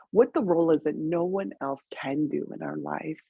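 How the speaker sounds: ordinary delivery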